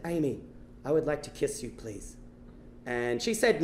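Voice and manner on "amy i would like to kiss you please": low, soft, hushed plea, sinking at the end; timid request. Acted. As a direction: ask shyly for a kiss, very quietly. Shy request for a kiss, retold.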